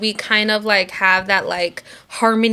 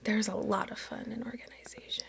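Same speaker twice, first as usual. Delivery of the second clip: whispering; 19 dB quieter than usual. Real, not acted. Whispered retort.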